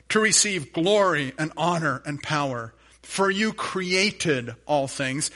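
slow, measured reading of the verse; preacher reading Revelation to the congregation, solemn; solemn scripture reading. Acted. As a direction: lay each phrase down slowly and reverently. Reads scripture aloud.

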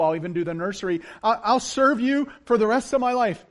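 loud, frantic bargaining with a laugh; desperate pleading. Acted. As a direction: beg for your life, promising everything. Jack bargaining with God.

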